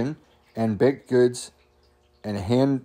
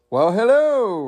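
high, swooping snooty British greeting; snooty British accent; 9 semitones higher, 4 dB louder, slower than usual. Acted. Raccoon greeting the mailman, snooty British accent. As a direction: put on a hammed-up British hello, falling at the end. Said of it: "in a snooty British accent".

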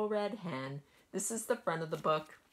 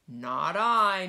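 meowing cat; 3 semitones higher, 10 dB louder than usual. A narrator's reading. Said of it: "meowed the cat".

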